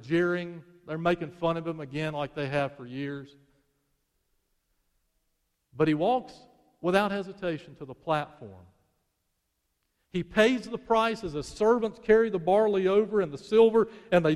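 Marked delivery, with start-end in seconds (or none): none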